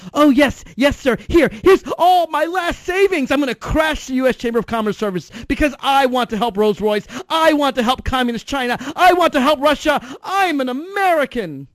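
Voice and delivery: mock eager dupe. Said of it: "And you say".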